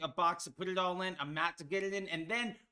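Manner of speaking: ordinary delivery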